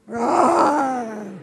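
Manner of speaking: growled tiger roar. Shown as a demonstration. Choosing tiger on the count of three, roaring. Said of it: "everybody be whatever they are".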